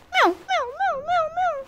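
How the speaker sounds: high, squeaky mock meows at the cat; high mock meowing; cat meow imitation.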